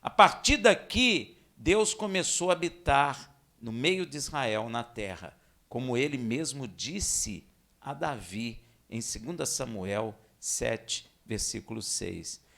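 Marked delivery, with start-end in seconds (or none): none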